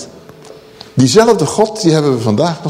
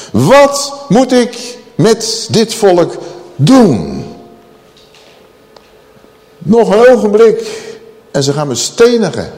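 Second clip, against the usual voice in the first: slow, word-by-word acted outcry, raised high; loud crying out; 5 semitones higher, slower than usual. Moses calling to God about the people, loud crying out. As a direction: call out desperately in separate beats. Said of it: "Toen riep Mozes luid tot".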